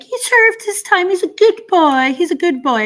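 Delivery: high, loud mocking voice; mocking women defending him. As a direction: mimic the fawning defenders in a high cartoonish pitch. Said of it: "those women over there, like".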